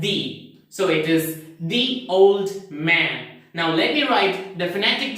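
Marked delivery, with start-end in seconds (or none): 0.0-0.5 "the" said like "dee"; "the" as thee. Shown as a demonstration. Teacher applies rule to second example, "the" as thee. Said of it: "you have to pronounce this word as". 1.6-3.4 put-on "the" like "dee" before "old"; "the" as thee. Shown as a demonstration. Teacher gives students the example, "the" as thee. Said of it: "you have to pronounce this word as".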